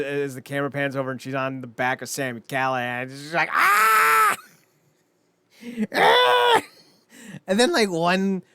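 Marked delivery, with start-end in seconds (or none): 3.5-4.4 high squealing mock battle cry; mock scream. Imitating Alicia's high squeal as she jumps on a wrestler. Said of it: "you just hear her screaming". 5.9-6.6 high squealing mock battle cry; mock scream. Imitating Alicia's high squeal as she jumps on a wrestler. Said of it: "you just hear her screaming".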